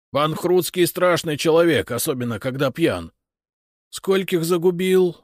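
cowed farmer's urgent account; frightened farmer informing an officer. A narrator's reading. Narrator voices frightened farmer's account.

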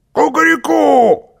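high, loud cock-a-doodle-doo; rooster crow. A narrator's reading. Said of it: "раздалось в третий раз".

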